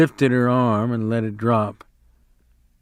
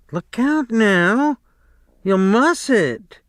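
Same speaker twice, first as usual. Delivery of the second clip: warning; 11 semitones higher, 3 dB louder, slower than usual. A narrator's reading. Wife warns Lenny off.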